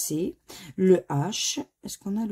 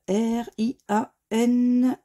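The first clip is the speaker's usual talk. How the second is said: ordinary delivery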